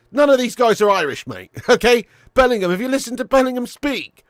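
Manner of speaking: loud, high-pitched rant; heated insistence. Real, not acted. Streamer telling a viewer none are Irish, heated and loud. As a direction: hammer the point home loudly.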